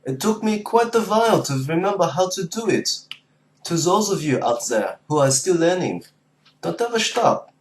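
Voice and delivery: German-accented English, encouraging learners. Shown as a demonstration. Demonstrating accents to viewers who are still learning, German accent. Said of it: "I love listening to the German accent".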